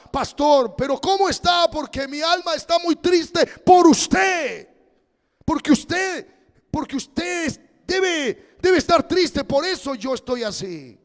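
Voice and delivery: high, loud, near-shouting plea; distressed brother. Retold or imitated. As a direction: pour out worry as the fretting brother.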